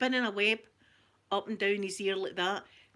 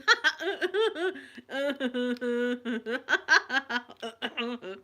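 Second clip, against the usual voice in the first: uncontrollable laughter; 2 semitones higher, 4 dB louder than usual. Retold or imitated. Me at the funeral losing control, long laughing fit. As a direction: keep laughing, unable to stop. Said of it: "I'm going".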